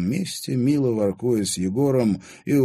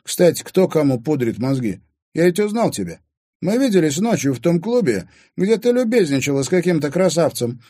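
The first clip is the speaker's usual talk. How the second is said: lightly voiced young challenger, raised pitch; Timofey, challenging; 9 semitones higher, 5 dB louder than usual. A narrator's reading. Timofey confronting stepmother about the club, challenging. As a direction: turn the accusation back pointedly.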